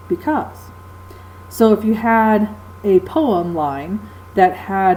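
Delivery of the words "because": because with the accent landing on -CAUSE; stress on second syllable. Shown as a demonstration. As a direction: demonstrate the weak-strong beat of because. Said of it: "the second syllable is accented".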